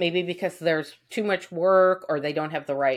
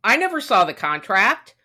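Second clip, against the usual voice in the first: much higher, disbelieving what are you talking about; incredulous outrage; 7 semitones higher, 4 dB louder, faster than usual. Retold or imitated. Texting the tile guy back, high incredulous outrage. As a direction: sound baffled and offended at once. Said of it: "I said".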